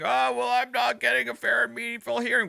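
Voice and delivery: high mocking whine; whiny voice. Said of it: "he's going to whine".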